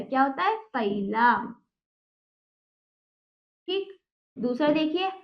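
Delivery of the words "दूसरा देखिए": ordinary delivery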